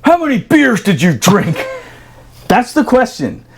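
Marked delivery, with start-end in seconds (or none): 0.0-1.6 interrogating officer. Retold or imitated. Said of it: "And he goes".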